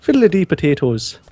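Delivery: mock stage Irish; Irish accent. Shown as a demonstration. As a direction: do a quick joke Irish accent. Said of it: "can you do, uh, an Irish accent".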